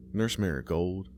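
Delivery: usual speaking voice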